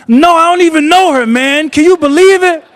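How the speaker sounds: a retold protest to a friend, rising in disbelief; exasperated protest.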